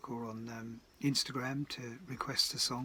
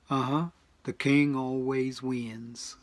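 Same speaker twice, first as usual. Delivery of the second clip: put-on slow, lightly drawled American [name] accent; a laid-back [name] croon, slow; 8 dB louder, slower than usual. [name] quoted in the story.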